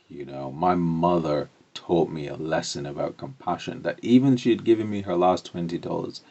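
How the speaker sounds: normal talking voice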